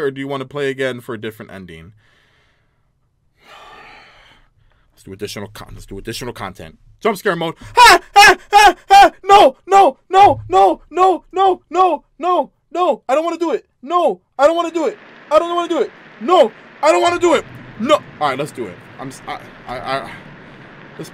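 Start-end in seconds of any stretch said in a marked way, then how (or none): 3.4-5.7 soft, breathy sigh through the words; sighing reluctance. Real, not acted. Gamer reluctantly picking more content, sighing. 7.8-17.9 high loud repeated no cries; terrified screaming. Real, not acted. Gamer screaming through jump scare mode.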